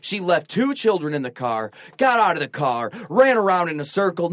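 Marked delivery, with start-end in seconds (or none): none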